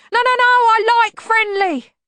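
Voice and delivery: very high, loud, drawn-out wail; frantic bogeyman yelp; panicked. A narrator's reading. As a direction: backtrack in shrieking panic.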